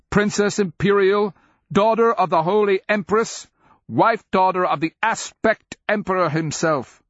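high, loud royal command; crying out. Acted. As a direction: proclaim her titles, demanding. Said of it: "she cries".

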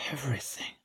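breathless hushed whisper; dazed girl whispering her vision. A narrator's reading. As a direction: let the word barely escape. Awed answer in a trance.

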